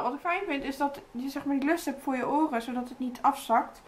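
lips framed by mask hole; through a sheet mask. Real, not acted. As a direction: mouth movement slightly restricted. Seen 0: Pink sheet mask covers whole face with small mouth hole throughout.

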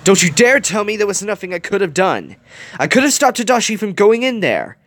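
high, loud, guilt-driven tirade; angry snap. A narrator's reading. Gogo insists she could have saved Tadashi, furious outburst. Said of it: "Gogo snapped".

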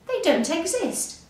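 high-pitched, incredulous denial; surprised. A narrator's reading. Hare tells friends monsters don't exist, surprised. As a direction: protest in amazed disbelief.

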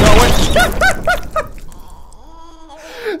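high, helpless laughter; loud cackle tailing off; hysterical laughing. Real, not acted. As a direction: lose it laughing at the game. Laughing at the game.

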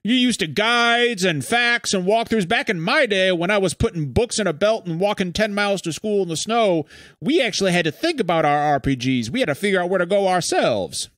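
put-on crotchety grandpa lecturing kids; old man voice. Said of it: "That's the best old man voice I got".

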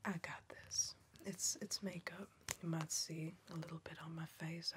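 whisper. Acted. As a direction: keep it all breath, no voice. Whispered roleplay throughout.

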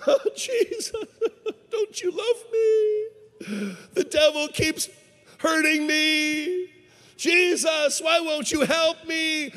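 high, loud, hammed-up wailing; mock crying. Man begs Jesus for help, exaggerated crying. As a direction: bawl it out in drawn-out fake sobs. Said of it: "he was crying and he was saying something like this".

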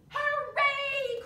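high loud hooray; cheering. A narrator's reading. Bugs cheering their victory, high and loud. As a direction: cheer in triumph. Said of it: "cried the bugs".

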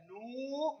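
teacher drawing out the vowel in نو; long waw. Shown as a demonstration. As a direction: stretch the uu of نو. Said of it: "مد الواو".

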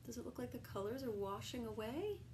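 ordinary delivery

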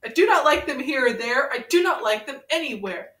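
sing-song read-aloud to a friend; storybook reading. Acted. Reading Green Eggs and Ham to Ren, storybook lilt. Reads Dr. Seuss book aloud.

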